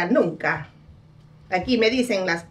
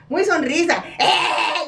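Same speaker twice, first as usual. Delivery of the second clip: mocking laugh; 7 semitones higher, slower than usual. Mocks [name]'s smile.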